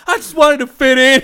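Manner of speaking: very high, loud falsetto whine; hammed-up high friend; whiny excuse. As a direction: plead in a squeaky voice.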